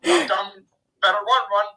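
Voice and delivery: caveman voice. Retold or imitated. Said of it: "this is a caveman that's saying this".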